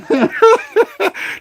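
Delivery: high burst of laughter; real laugh breaking out. Real, not acted. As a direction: crack up at your own bit. After the witch act.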